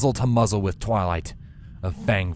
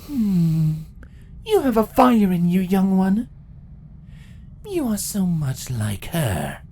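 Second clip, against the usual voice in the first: amused, thoughtful empress, higher; musing villain, slow at the end; 11 semitones higher than usual. Acted. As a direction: savor the girl's anger. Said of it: "the Empress mused".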